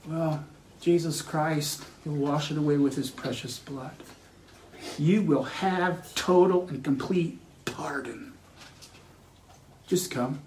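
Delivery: low, very soft pulpit appeal; hushed preaching. Acted. Preacher inviting sinners to full pardon, hushed preaching. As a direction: drop right down and plead gently.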